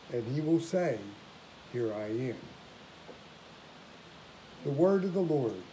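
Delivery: ordinary delivery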